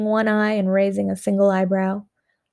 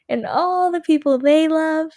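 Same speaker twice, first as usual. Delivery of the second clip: higher and brighter on they love; building excitement; 7 semitones higher, 3 dB louder than usual. A narrator's reading. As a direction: lift "they love" high with rising excitement. Climax of repeated phrase.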